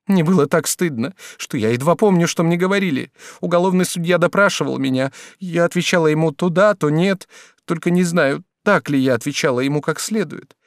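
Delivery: high, hurried-feeling confession; flustered, ashamed woman. A narrator's reading. Woman tells lover of interrogation, flustered. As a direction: recount it in a lifted, distressed voice.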